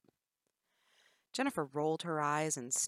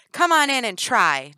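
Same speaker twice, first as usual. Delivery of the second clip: loud, higher goading taunt; 7 semitones higher, 16 dB louder than usual. Acted. Jennifer goading Danny into the cage, loud. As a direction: bait her into the cage. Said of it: "Jennifer goaded".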